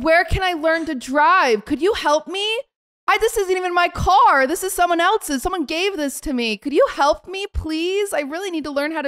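high, put-on helpless plea; mock pleading. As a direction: beg sweetly for help, insincerely. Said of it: "I'm being a psychopath".

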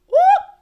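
a sudden, very high and loud whoop; excited whoop. Real, not acted. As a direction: let out a happy cheer, very high and loud. Excited cheer for a song.

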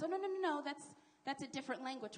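high, loud, rapid-fire objection; mock protest. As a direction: imitate someone hurriedly overruling a correction. Mimicking defenders of the argument.

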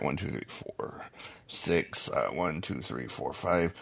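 hushed count under the breath; whispered counting. Real, not acted. Counting his list to himself under his breath, whispering.

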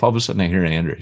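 ordinary talk, nothing marked

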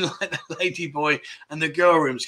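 ordinary delivery

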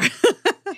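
bright high burst of laughter; quick amused laugh. Real, not acted. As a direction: laugh out at the joke. Host laughs at hardcore batching.

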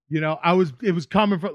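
usual speaking voice

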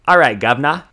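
British Statham voice. Retold or imitated. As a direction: lightly put on Jason Statham's British accent for the baby. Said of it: "looks at the baby and goes".

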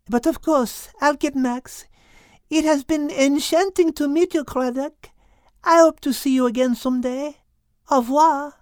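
put-on high French-accented voice, calmly asking; high, poised French accent. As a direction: play Liz with a French accent, asking the whale. Liz French voice.

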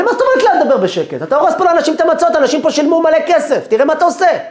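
fast, high, very loud rant; outraged shouting. Retold or imitated. Boss yells back at quiet plea. (צעקות זעם מהירות בקול גבוה)

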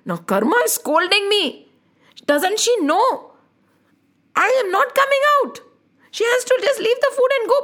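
high, puzzled little god, pitch climbing; lightly voiced Krishna wondering why he is being scolded; Krishna's inner voice. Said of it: "he was wondering".

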